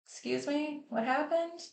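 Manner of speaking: high, softened questioning in mock innocence; mock bewilderment. Insurer or onlooker finding no police report, mock bewilderment. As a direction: imitate someone politely baffled. Imitated bewildered reaction to missing records.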